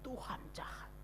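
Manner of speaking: hushed whisper of blame; whispered bitterness. Retold or imitated. Griever blaming God, in a bitter whisper. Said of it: "marah dia kan".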